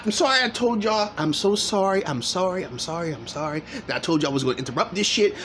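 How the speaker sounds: ordinary delivery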